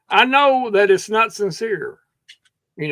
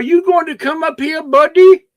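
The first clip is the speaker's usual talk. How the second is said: calling out; 10 semitones higher, 8 dB louder than usual. Real, not acted. Calling someone to come up.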